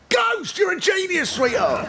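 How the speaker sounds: high, delighted outburst; suddenly struck by a brilliant idea; excited. Retold or imitated. Shakespeare thanking his wife Anne, delighted and high.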